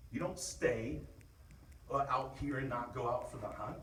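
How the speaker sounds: firm, rallying coach talk; rally the team before the game; pep talk. Real, not acted.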